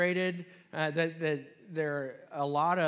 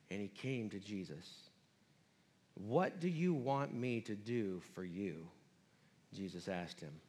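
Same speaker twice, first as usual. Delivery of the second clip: quiet reading; 7 semitones lower, 6 dB quieter than usual. Acted.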